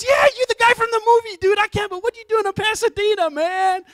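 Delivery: very high, loud fanboy shriek; hammed-up star-struck American dude; wildly excited teller. Teller meeting film figure in Pasadena, wildly excited. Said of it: "And he's so excited".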